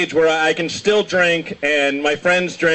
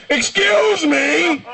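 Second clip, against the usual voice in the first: high, drawn-out imitation holler; old man calling out; 12 semitones higher, much slower than usual. Old man interrupting a crowded restaurant, high drawn-out shout. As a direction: call out as a hammed-up indignant old man. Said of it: "you just hear this old man go".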